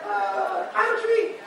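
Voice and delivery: squeaky-high goblin insisting he's a tree; goblin pretending. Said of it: "I'm just like".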